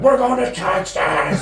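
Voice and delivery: high, drawn-out holler breaking into laughter; big jubilant yell; celebratory holler. Retold or imitated. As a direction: whoop it out, stretching the words. Cheering the news in his story.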